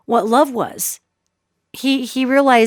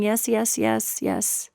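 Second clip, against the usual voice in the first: soft, broken, retold sobbing; sobbing yes; 8 dB quieter, much slower than usual. Dying man answering the psychic through retold sobs. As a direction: barely get the words out through tears. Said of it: "He's just sobbing".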